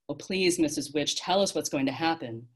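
plain reading voice

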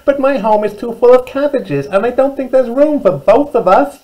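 high, loud, twittery rabbit voice; twittering rabbit. Acted. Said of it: "twittered Rabbit".

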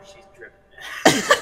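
slow, breathy, exaggerated; drawn-out mock line. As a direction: stretch it out for the joke. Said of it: "Quote unquote".

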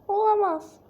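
alarmed cry. Acted. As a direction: cry out in shock. Trapped character cries out.

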